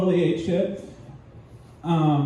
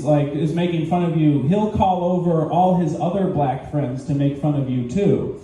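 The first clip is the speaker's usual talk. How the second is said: normal talking voice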